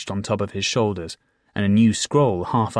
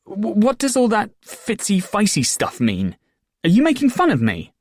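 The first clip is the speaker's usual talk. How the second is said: embarrassed; 12 semitones higher, 4 dB louder than usual. A narrator's reading. Embarrassed boy questions the fool.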